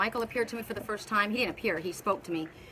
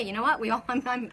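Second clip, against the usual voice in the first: ordinary talk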